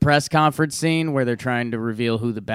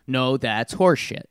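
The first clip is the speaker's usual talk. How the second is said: normal talking voice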